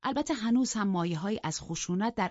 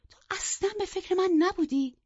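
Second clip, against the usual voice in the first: high, loud, stress on من; loud reproach; 6 dB louder than usual. A narrator's reading. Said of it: "بلند بلند گفت".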